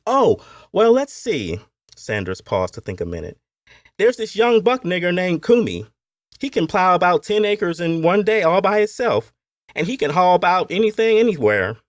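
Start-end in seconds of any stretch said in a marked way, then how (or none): 0.1-1.6 high Appalachian overseer, loud start; overseer pondering. A narrator's reading. Overseer thinks it over. 4.0-11.8 high, loud overseer voice; Appalachian overseer bragging. A narrator's reading. Overseer figure.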